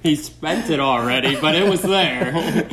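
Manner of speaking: loud laughter through the words; laughing. Real, not acted. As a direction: laugh out the punchline. Joking, laughing at James.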